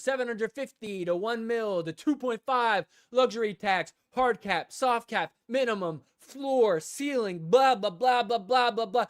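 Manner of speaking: fed-up listing, blah blah; dismissive rant. Real, not acted. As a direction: rattle it off mockingly. Ranting about numbers.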